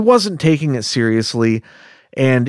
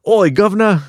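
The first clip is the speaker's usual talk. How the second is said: put-on horrible British accent; 10 semitones higher, 4 dB louder than usual. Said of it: "our horrible accents".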